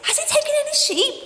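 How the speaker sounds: high, fast, hushed worried questions; anxious villagers. Acted. Villagers questioning the boy in a high anxious rush. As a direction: pelt him with worried questions.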